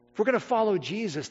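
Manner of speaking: ordinary delivery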